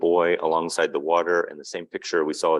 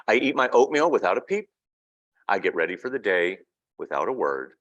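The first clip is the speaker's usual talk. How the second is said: picture book reading; 3 semitones higher, 4 dB louder than usual. A narrator's reading. Reading a picture book aloud to an audience, storybook narration. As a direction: read the child's words gently to the room.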